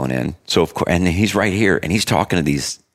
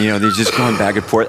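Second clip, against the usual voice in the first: ordinary talk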